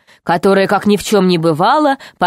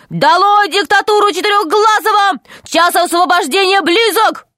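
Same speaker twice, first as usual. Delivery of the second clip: high, rousing rally cry; fiery revolutionary speech; 10 semitones higher than usual. A narrator's reading. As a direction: shout them up to revolt. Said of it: "закричал". (пламенный революционный призыв)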